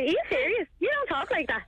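imitating a baffled friend, high and swooping; jaw-dropped disbelief. Friend questioning her phone voice, imitated disbelief. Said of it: "and the jaw hit the, hit the floor".

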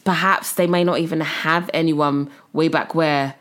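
usual speaking voice

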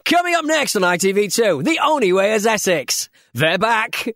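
continuity announcer voice. Shown as a demonstration. Giving co-hosts a taste of the old ITV2 job, loud announcer voice. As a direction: sell the next show like a hammed-up channel announcer. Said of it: "Give us a, give us a".

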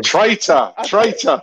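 loud teasing "traitor" call; mock accusing shout. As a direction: jeer it playfully, loud and high. Mock-accusing Ricky over exclusive.

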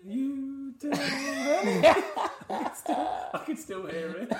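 high-pitched laughing squeal. Real, not acted. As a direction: giggle through it. Mimicking kiss, laughing.

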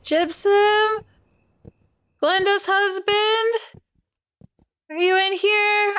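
high, slow, hesitant calling out; timid call. Acted. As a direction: call out gingerly into an empty room. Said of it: "not really yelling, but, like, voice raised slightly".

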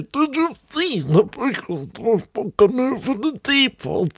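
put-on high, slow, soft old lady voice; muddled chatter of the frail aunt; Auntie Ruby. Great-aunt grasping your arm, high and slow. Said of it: "grasp your arm and say".

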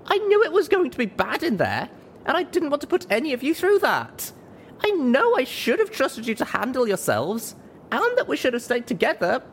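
very high, pleading outburst; desperate. A narrator's reading. Narrator apologises to friends for stupid plan, desperate. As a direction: confess and plead at the top of your range. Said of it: "I looked to my friends desperately".

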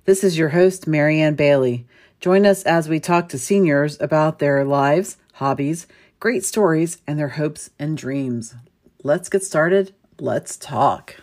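polished host intro read; show intro. Acted. Host opening her podcast, polished announcer read. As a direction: welcome listeners like a radio host.

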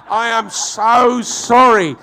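loud, high, stretched-out apology; hammed-up heartfelt sorry, every word drawn out; effusive apology. Retold cabbie's effusive apology.

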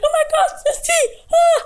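high, squealing fan voice; excited fans. Retold or imitated. Fans squealing at the DJ. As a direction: squeal her name, starstruck. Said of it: "So many people are like".

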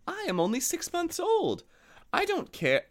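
high timid lamb. A narrator's reading. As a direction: plead in a high little lamb voice. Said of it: "said the lamb".

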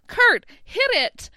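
high, drawn-out call to perform; mock showbiz cue. Rachel cueing Kurt to soothe the crowd, theatrically.

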